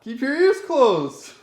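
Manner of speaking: loud. Real, not acted. Ordering her to cover ears.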